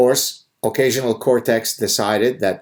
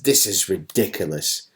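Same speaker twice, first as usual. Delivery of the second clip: mock British voice; 3 semitones lower, 4 dB quieter than usual. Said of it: "goes on and says".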